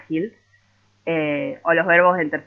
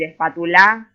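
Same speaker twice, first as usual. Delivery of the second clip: "espátula" said as espatuLÁ, last syllable loud and raised; exaggerated stress, wrong syllable; 6 dB louder than usual. Shown as a demonstration. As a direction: punch the final "la" of "espátula". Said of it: "es exagerando un poco".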